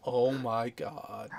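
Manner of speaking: usual speaking voice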